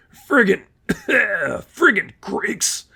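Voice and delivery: put-on high, slurred drunk voice with a cough; drunk thug. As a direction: play the sick drunk.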